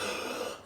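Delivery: re-enacted sharp, breathy intake of air; deep breath. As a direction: suck in a big breath after a scare. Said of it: "I took a deep breath".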